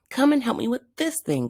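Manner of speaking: high, fast call for help. Acted. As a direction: call over quickly to a teammate. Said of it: "called Chuckles".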